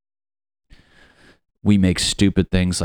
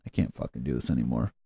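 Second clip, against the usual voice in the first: muttered despair; 7 semitones lower, 8 dB quieter, faster than usual. Real, not acted. Exasperated muttered aside.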